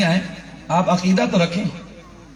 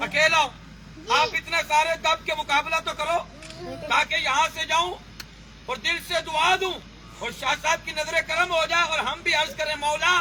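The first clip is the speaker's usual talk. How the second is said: pitched-up preaching, softer on mic; high preaching voice; 11 semitones higher, 5 dB quieter than usual. Acted. Preacher urging the crowd to answer back, pitched high. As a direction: press the crowd to match you.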